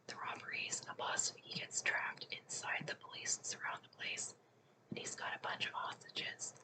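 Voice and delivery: ordinary delivery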